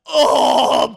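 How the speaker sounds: loud, long, high "oh"; acted groan of losing control; mock straining groan. Dwarf soils himself again, hammed-up loud groan.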